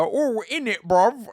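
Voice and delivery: high, badly faked London British accent; mock British accent. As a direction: attempt a silly British street accent. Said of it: "or whatever they fucking say. I can't do it".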